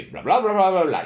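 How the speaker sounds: mock-deep father going bla bla; father's low babble. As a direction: drop low like a scolding dad, then lift. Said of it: "El padre hace".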